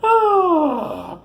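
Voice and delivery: long, high falling wail after laughing; spent, high "ah"; high laughing sigh. Real, not acted. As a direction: let out a loud, high sigh as laughter winds down. Comes down from his laughing fit.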